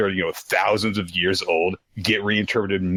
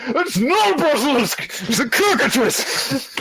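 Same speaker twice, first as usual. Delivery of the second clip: high strained mock scream; hammed-up furious shriek; angry screaming. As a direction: scream it like an enraged nerd at a screen. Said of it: "Just screaming angrily at your computer".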